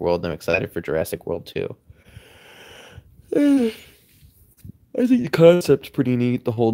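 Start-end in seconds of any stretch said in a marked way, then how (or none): 3.3-6.7 yawn-stretched words. Real, not acted. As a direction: talk mid-yawn, slow. Yawning through live stream talk.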